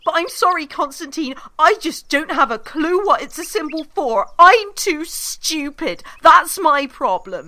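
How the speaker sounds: loud, fast, biting sarcasm; furious rant. Acted. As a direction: tear into him, sarcastic. Nina's furious tirade at Constantine.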